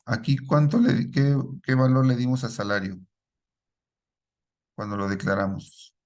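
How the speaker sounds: normal talking voice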